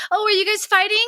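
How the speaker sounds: high-pitched mock-concerned mom; playful, high mommy voice; teasing mom. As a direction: tease the kids in a high voice. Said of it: "I do that with my kids".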